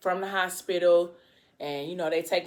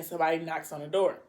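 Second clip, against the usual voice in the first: normal talking voice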